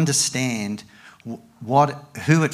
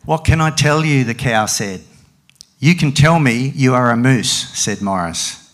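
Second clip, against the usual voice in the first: animated picture-book reading with raised, loud dialogue; story reading to a child; 5 dB louder than usual. Acted. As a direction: perform the animals' argument, drop for the tags. Reads picture book aloud.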